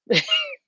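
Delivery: high nervous giggle burst; nervous laugh. Real, not acted. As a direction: let a nervous giggle slip out. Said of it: "I told you I do the nervous laugh thing".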